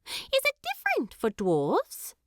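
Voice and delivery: high, fast, prim hobbit lass teasing; Primula. Acted. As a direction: rattle off the rules like a proper young hobbit. Primula asks.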